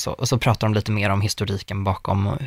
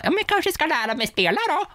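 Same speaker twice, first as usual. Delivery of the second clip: much higher, put-on eager voice; imitating herself in a much higher voice; mock eager self. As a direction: play her own naive plan as a bright cartoon. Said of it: "Så då tänkte jag att så här".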